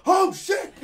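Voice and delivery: high, loud shocked yell; startled exclamation. Retold or imitated. Friend retelling being blinded by super-bright headlights, startled yell. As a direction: burst out in alarm, high and loud.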